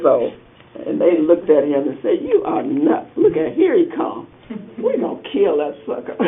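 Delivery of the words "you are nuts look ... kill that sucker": lightly voiced jeering brothers, pitched up; mocking brothers. Jealous brothers jeering at young Joseph, mildly mocking. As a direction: sneer at the dreamer like a scornful older brother.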